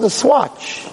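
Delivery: high, mocking disbelief; incredulous echo. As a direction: repeat "Swatch?" like it's absurd. Lawyer echoes in disbelief.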